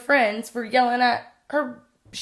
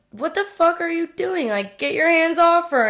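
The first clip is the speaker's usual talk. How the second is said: high, loud retold yell at an attacker; reliving the outrage of yelling at him; angry yell; 8 semitones higher, 5 dB louder than usual. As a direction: shout him off her. Confronting man choking girlfriend.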